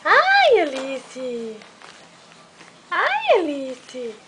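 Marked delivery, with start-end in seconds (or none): 0.0-0.6 high, loud squeal of joy; high delighted cry. Real, not acted. Aunt squealing at the baby in delight. 2.9-3.4 high delighted cry. Real, not acted. Aunt squealing at the baby in delight.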